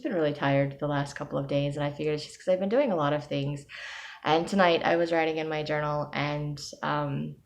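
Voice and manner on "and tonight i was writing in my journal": ordinary delivery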